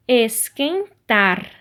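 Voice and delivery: Esquentar very slow, split up, TAR punched; stress on last syllable. Shown as a demonstration. Teacher showing pupils the strong syllable, slow stress on TAR in Esquentar. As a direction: sound out Esquentar slowly and land on TAR. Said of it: "a sílaba forte".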